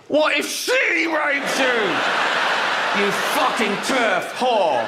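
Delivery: high, slow mock-furious shouting; hammed-up outrage at a bigot; mock outraged shout. Mocking an activist, hammed-up outraged shout.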